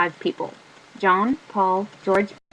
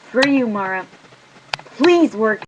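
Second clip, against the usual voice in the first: louder plea; 2 semitones higher, 6 dB louder than usual. Real, not acted. Louder plea, same words.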